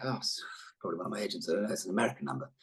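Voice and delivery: low, soft mutter; muttering to himself. Retold or imitated. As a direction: grumble it quietly to yourself. Acting out his reaction to unknown caller.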